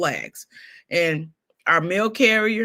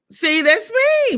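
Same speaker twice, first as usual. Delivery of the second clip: much higher, slow, emphatic admission; high exclamation; 7 dB louder, slower than usual. Real, not acted. Admitting to viewers she nags too, exclaiming. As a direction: own it loudly. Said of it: "admitting she does it too".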